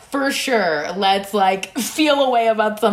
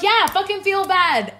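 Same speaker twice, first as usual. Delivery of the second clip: sarcastic retort; 11 semitones higher than usual. Retold or imitated. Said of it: "I'm like".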